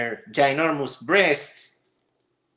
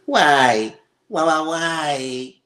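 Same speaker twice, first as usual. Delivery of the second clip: exasperated why; 5 dB louder, much slower than usual. Real, not acted. Exasperated at the vegan terms.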